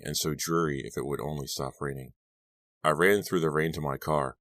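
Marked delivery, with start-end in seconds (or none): none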